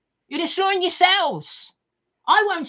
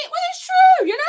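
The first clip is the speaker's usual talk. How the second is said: sudden outburst; 7 dB louder than usual. Real, not acted. Outburst in a rant.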